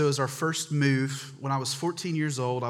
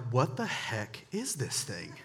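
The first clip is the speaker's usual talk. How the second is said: slow, hushed bewilderment; baffled reaction; 7 dB quieter, slower than usual. Retold or imitated. Boy finds a phone in a leather bag, baffled. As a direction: draw the question out in puzzlement.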